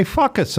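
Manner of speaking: put-on accented FUCK US, jumping high on the first syllable. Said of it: "accused of mocking Hispanics".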